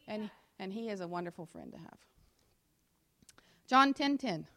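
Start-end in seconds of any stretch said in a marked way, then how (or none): 3.7-4.5 loud, high announcement of "John ten ten"; called-out reference. Shown as a demonstration. Preacher announcing the next scripture, loud. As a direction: call out "John ten ten" to the room. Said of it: "What did I skip?".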